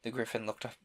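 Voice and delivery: ordinary delivery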